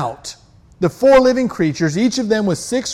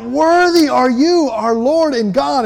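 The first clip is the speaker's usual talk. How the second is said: crying out; 6 semitones higher than usual. Acted. Elders around the throne crying out, fervent praise. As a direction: proclaim it up to the throne. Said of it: "They're crying out".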